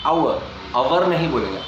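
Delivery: usual speaking voice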